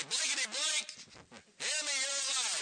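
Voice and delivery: high-pitched, hammed-up cursing; mock mad. Preacher jokingly demonstrates cursing the devil's words, mock angry outburst. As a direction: pretend to tell off a liar in a temper. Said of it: "I was just mad".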